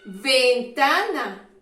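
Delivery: Ventana drawn out slowly and loudly; slow, loud model word. Shown as a demonstration. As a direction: call out Ventana slowly for the class. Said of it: "Contiene la sílaba V".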